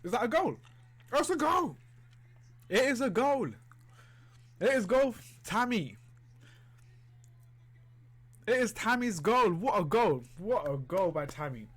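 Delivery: loud, high goal celebration; excited shouting. Real, not acted. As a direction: yell it out as the goal goes in. Goal celebration while watching match.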